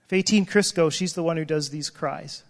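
ordinary talk

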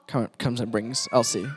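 usual speaking voice

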